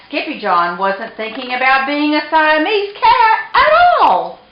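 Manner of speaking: ordinary delivery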